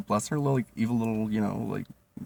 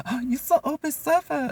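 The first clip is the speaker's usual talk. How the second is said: put-on much higher, louder accented coworker voice; imitating coworker; 5 dB louder, slower than usual. Coworker teasing his age, high mock accented voice. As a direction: tease it in her high put-on voice. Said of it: "she's like".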